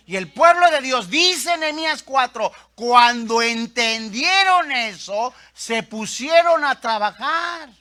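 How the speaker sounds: loud, rousing sermon climax; punched list building with fervor; full-force preaching. Real, not acted. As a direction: drive each phrase home to the congregation.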